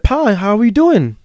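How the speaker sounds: high, slow motherly greeting; sing-song concerned hello; Mama Maria. Retold or imitated. Voices Mama Maria greeting him.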